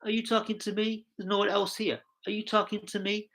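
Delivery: high, fast, mock-tough film line; movie quote impression. Host quotes the film to his chat, high and fast. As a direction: throw the famous film challenge at the camera. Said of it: "It's like that, uh, film".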